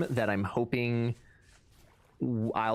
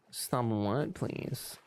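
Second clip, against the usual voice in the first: whisper; 4 dB quieter, much slower than usual. Pretend. Mock whisper echoing the request.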